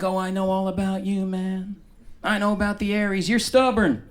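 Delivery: a know-it-all reading your star sign; knowing woman at a bar. Retold or imitated. A woman at the bar telling him his sign, a hint of smug. She's like.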